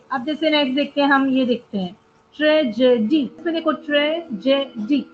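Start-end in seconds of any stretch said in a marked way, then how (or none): 2.4-3.2 tragedy in three evenly parted syllables. Shown as a demonstration. Teacher introducing the next example, tragedy split by syllable. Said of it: "आपको तीस बार syllables बोलने पड़ रहे हैं". 3.7-5.1 tragedy broken with two clear pauses; syllable by syllable. Shown as a demonstration. Teacher pointing out three syllables, tragedy split by syllable. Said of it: "आपको तीस बार syllables बोलने पड़ रहे हैं".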